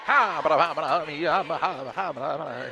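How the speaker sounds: fast auctioneer chant. Pretend. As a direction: rattle it off like an auctioneer. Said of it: "your auctioneer voice".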